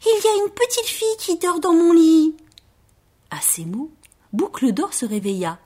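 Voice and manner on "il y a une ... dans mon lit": high, louder little-bear cry; astonished Baby Bear. A narrator's reading. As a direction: announce it amazed, in a baby bear voice. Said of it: "s'exclama bébé ours étonné". (bébé ours stupéfait, voix aiguë et forte)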